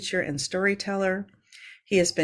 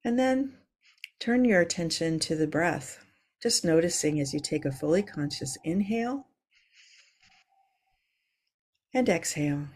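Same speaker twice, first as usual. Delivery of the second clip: calm grounding exercise. Acted. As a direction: ease them into their breath. Leads a yoga breathing moment.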